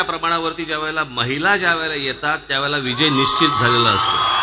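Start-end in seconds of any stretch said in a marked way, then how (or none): 2.8-4.4 slow, loud proclamation; rally shout. Real, not acted. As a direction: proclaim victory to the crowd. Rally climax.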